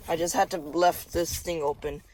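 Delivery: normal talking voice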